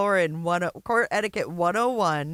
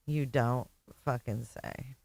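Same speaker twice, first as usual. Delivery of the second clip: deadpan sarcasm; 6 semitones lower, 6 dB quieter than usual. Real, not acted. Sarcastic reply to obvious point.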